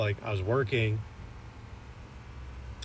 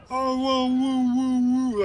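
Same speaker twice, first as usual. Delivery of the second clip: long high howling whoa, much higher and louder; imitated dog howl; 6 dB louder, slower than usual. Guy imitating the dog howling at the door, high drawn-out howl. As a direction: howl it like a lonely German Shepherd. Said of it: "like, moaning".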